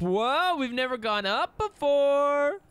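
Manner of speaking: sudden delight at going up; surprised. Real, not acted.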